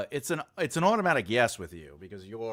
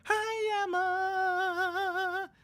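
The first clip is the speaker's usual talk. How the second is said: very high drawn-out sing-song greeting; high, squealed mock impression; impression of Emma; much slower than usual. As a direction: stretch the name out way up high. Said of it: "That impression was uncanny".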